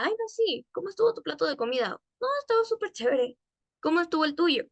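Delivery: acted friend. Acted chat about a dish.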